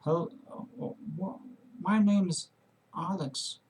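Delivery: faltering, stammered self-introduction with quiet trailing ends; halting first attempt. Learner mimics first attempt at speaking aloud, halting and stammering. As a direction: imitate stumbling through a first try at speaking, barely getting words out. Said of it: "it was like this".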